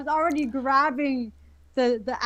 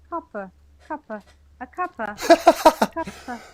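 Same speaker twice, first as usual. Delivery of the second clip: attempted British accent; 5 dB louder than usual. Shown as a demonstration. Canadian trying out British words from TV, attempted British accent. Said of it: "hear me try to speak British".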